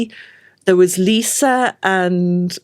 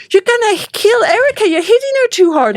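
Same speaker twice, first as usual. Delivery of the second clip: high, loud alarmed cry; sister's alarmed cry; 12 semitones higher, 5 dB louder than usual. Retold or imitated. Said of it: "my sister saying to me".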